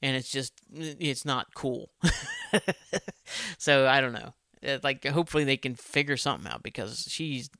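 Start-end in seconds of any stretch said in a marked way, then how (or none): 1.9-3.5 real chuckle; short laugh to himself after a gripe. Real, not acted. Speaker chuckles after griping about Galadriel, real laugh.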